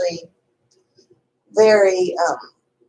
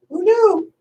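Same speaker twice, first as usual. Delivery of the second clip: surprised; 12 semitones higher, 5 dB louder than usual. Real, not acted. Amused surprise at fun fact.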